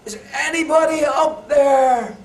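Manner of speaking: high, loud, slow call upward; desperate shout. Retold or imitated. Man still hanging from branch shouts up, slow loud yell. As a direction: drag out the plea, yelling skyward. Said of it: "and he shouts".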